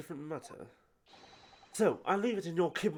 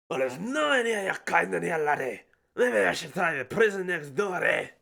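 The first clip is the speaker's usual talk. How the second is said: hammed-up Scottish governor, louder; Scottish accent; 7 dB louder than usual. Governor introducing himself, in a hammed-up Scottish accent. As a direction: boom it in a stage Scottish accent. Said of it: "Oh, he is Scottish?".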